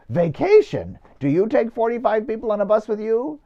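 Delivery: incredulous comeback, lightly hammed-up; mock indignation. Priest answering parishioner who called pilgrimage a vacation, mock indignant. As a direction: play offended disbelief for laughs.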